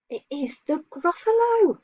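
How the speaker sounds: high, gasping storyteller reveal to the class; mock-amazed teacher unveiling the monster; excited reveal. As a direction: build it up like a big surprise. Gasp before revealing the Gruffalo.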